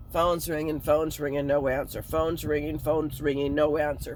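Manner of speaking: low monotone chant, evenly repeated; droning repetition. Retold or imitated. Hungover, hearing the phone ring on and on, droning. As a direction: drone it out like endless rings. Mimics endless unanswered phone ringing.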